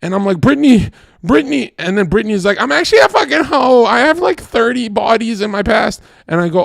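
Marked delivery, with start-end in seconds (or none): 0.4-1.7 high, urgent mock call. Man calls Britney from behind, calling out. 2.6-6.0 high-pitched put-on confession; mock Britney confession. Britney confesses her past to him, mock confession.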